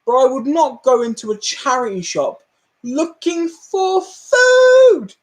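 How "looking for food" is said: food stretched very slowly, much higher; drawn-out indignant stress. Real, not acted. To chat about the shop, stretching food in disbelief.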